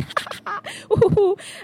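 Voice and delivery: a quick giggle, way up high; high laugh. Real, not acted. Laughing at her own fake excuse, high giggle. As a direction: burst of high-pitched laughter.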